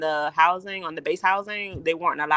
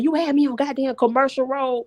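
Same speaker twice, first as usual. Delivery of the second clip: mild mock yell, pitched up; half-hearted scolding of the runaway dog; retold yelling at dog; 8 semitones higher than usual. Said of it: "I was yelling at the dog".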